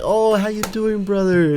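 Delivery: high, slow, overly friendly greeting; friendly opponent. Retold or imitated. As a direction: put on a sweet, high buddy voice. Imitating friendly opponent Sergio.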